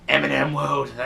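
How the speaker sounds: zombified drone, hammed up; zombie voice. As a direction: moan it like the walking dead. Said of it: "kind of like The Walking Dead".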